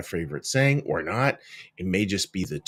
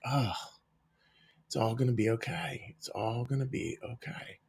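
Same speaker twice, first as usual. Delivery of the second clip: soft, gentle comfort; softer hushed comfort, fading quieter; soothing reassurance; 9 dB quieter than usual. Retold or imitated. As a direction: say it like a loved one calming you. Loved one's voice reassuring you.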